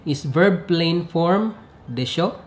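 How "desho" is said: "desho" with pitch climbing sharply; rising intonation. Shown as a demonstration. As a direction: say "desho" as a checking question. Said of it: "with a rising intonation".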